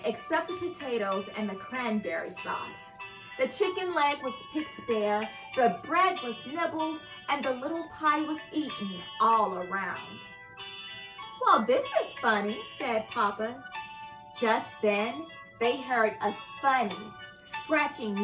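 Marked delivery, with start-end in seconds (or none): none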